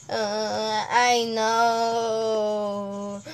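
ordinary delivery

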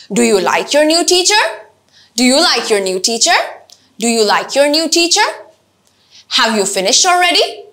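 teacher drills yes no questions rising at the end; rising intonation. Shown as a demonstration. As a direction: slowly climb to the last word of each yes no question. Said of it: "শুরুতে লো, পরে আস্তে আস্তে high".

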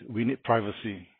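ordinary talk, nothing marked

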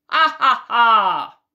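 loud, slow "ah, ah, ah" cackle; booming storybook villain laugh; wicked wolf laugh. A narrator's reading. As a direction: laugh like the big bad wolf. Wolf laughs at his prey.

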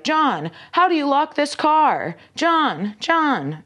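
hammed-up loud old man nagging for John; loud pensionist. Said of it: "The Loud Pensionist".

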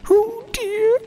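very high, slow cat in the hat; jolly cat voice. Acted. Cat calming the fish, high and slow. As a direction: reassure with a big showman's grin.

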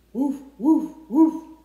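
loud, high barking woof; dog bark. Pretend. Librarian barks with kids, loud playful woofs. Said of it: "Let's bark together".

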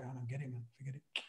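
dismissive. Retold or imitated. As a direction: mutter a bored dismissal under your breath. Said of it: "I'm just like".

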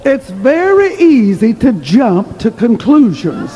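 high, projected preacher voice, building in pitch; measured opening rising to a high, forceful cry; emphatic preaching. Real, not acted. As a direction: drive the moral home to the congregation. Drives home the sermon's moral.

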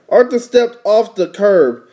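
ordinary delivery

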